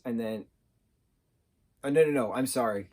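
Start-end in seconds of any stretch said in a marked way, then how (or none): none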